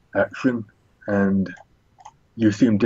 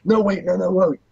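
high-pitched panicked plea; acted high, alarmed protest; pleading surrender; 10 semitones higher than usual. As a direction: beg them to hold off, voice pitched up. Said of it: "quickly puts up his hands like".

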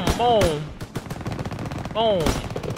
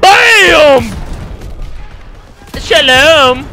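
loud, high victory yell; hype shout; 4 semitones higher, 5 dB louder, much slower than usual. Real, not acted. Gamer hyping up his quick scope kill, loud hype shout. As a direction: boast the trick shot at full volume. Hype after trick shot.

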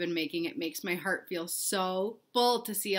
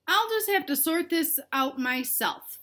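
determined; 8 semitones higher, 4 dB louder than usual. Acted. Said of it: "thought Bella".

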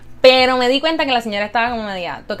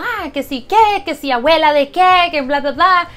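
mock rant; 6 semitones higher, 6 dB louder than usual. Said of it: "ella ahí como que diciendo".

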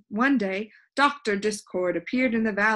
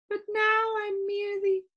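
high, lilting lady's voice; dreamy Soundkeeper; 11 semitones higher than usual. A narrator's reading. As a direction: rhapsodize in a high, airy character voice.

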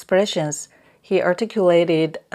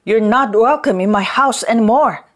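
loud, raised-pitch angry bark; barked dismissal; 5 semitones higher, 6 dB louder than usual. A narrator's reading. Young master banishes the mute from his house, barking. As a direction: bark it at someone being banished. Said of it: "he barked".

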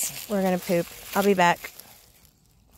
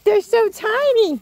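cooing; 9 semitones higher, 5 dB louder than usual. Real, not acted. Cooing over tiny dogs.

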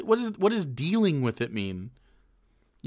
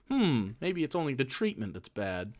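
ordinary talk, nothing marked

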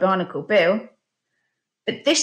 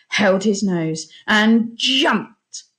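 ordinary delivery, nothing marked

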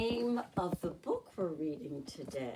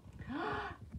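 excited gasp; 13 semitones higher, 5 dB quieter than usual. Acted. Excited gasp before next verse.

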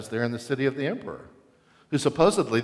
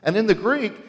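ordinary delivery

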